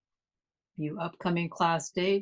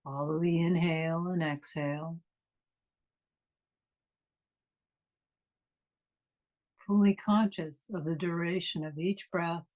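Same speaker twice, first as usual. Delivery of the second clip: guided meditation. Acted. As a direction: ease them inward, unhurried and calm.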